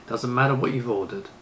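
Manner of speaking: ordinary delivery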